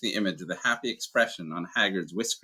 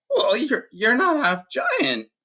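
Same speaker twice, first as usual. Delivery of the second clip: much higher, slow, croaking half giant; croaky Hagrid; 6 dB louder, much slower than usual. Acted. Hagrid to Dumbledore, high, slow and croaking. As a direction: croak it out, slowly and high, hurt. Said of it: "said Hagrid croakily".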